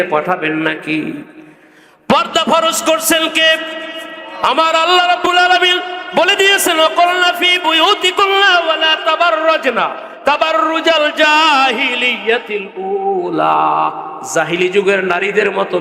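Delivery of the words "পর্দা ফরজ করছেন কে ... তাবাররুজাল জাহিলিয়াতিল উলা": high, forceful chant easing into a long-drawn close; impassioned preaching. Real, not acted. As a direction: proclaim scripture high, then stretch the last words. Preaches and recites scripture. (উঁচু সুরে টেনে তিলাওয়াতসহ ওয়াজ)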